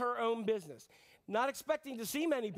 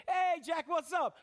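higher, bright buddy hello; casual angel greeting; 6 semitones higher, 3 dB louder, slower than usual. Retold or imitated. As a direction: greet him like a chummy angel. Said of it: "an angel came to me and said".

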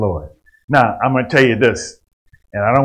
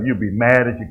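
ordinary talk, nothing marked

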